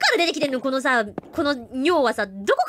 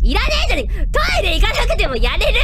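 higher and louder, elated yell; triumphant shout; 6 semitones higher, 7 dB louder than usual. Real, not acted. Streamer after beating the ghost, triumphant shout. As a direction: cheer the win.

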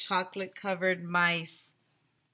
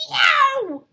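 loud, high imitated yowl; cat yowl; 11 dB louder than usual.

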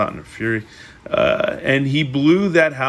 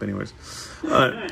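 ordinary delivery

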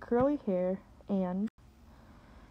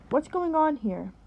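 loud, high sharp call; sharply; 7 semitones higher, 5 dB louder than usual. Acted. Pastor's wife cuts into the scolding, sharply. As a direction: cut her off with authority. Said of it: "a familiar voice said sharply".

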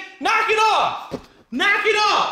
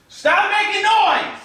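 ordinary delivery

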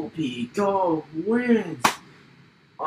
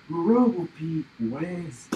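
ordinary talk, nothing marked

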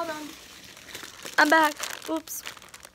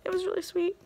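ordinary talk

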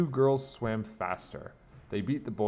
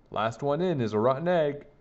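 raised, louder playful call; yell; 5 semitones higher, 5 dB louder than usual. A narrator's reading. Boy jumping into the pool to his friends, yelling. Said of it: "he yelled".

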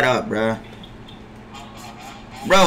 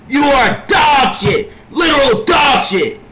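angry shout; 7 semitones higher than usual. Real, not acted. Streamer raging at his center, furious shout. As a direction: yell it at the useless teammate.

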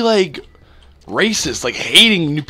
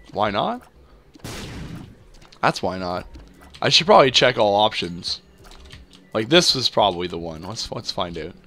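normal talking voice

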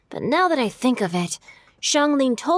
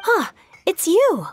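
surprised recognition; 8 semitones higher, 4 dB louder, slower than usual. Acted. Recognizing an unexpected guest.

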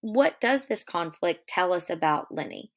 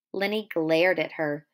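usual speaking voice

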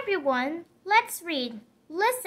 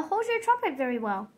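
quick, quieter, lower; rushed compliment; 6 semitones lower, 5 dB quieter, faster than usual. Acted. Gerald hurrying through a polite compliment to Piggy, fast and low. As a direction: hurry through the awkward praise.

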